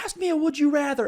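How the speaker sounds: high mock plea; begging. Gunman begging his hostages for a game, pleading. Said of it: "begging them to".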